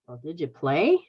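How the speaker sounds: ordinary talk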